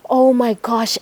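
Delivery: usual speaking voice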